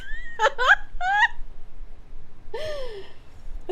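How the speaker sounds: high laughter. Real, not acted. Laughing at own IQ.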